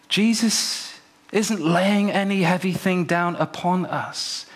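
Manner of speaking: ordinary talk, nothing marked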